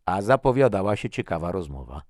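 a touch of irritated protest; bristling retort. A narrator's reading. Tomek reacting to Tori's gloomy remark, mildly irritated. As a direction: push back with mild annoyance. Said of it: "żachnął się Tomek".

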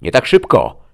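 high, very loud shout; loud, high-pitched challenge; commanding shout. A narrator's reading. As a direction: shout to stop them in their tracks. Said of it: "krzyknęła Destiny".

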